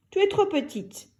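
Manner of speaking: a lightly voiced stern mother; a hint of a worried maman; mother's voice. A narrator's reading. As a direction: speak as a cautious mother. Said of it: "lui dit-elle".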